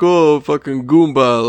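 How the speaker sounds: stretched-out Long Island goomba voice; Long Island Italian accent. Acted. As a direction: put on a loud Italian-American accent. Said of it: "kind of the same accent almost".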